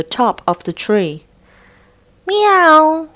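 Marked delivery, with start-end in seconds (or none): 2.3-3.1 high, louder little meow; storybook cat mewing. Acted. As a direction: mew like a cat stuck up a tree. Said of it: "she said".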